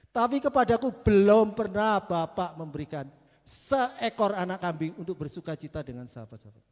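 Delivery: resentful elder son. Acted. Elder son resenting his father's stinginess, loud. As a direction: throw the grievance at the father. Elder son's complaint read aloud.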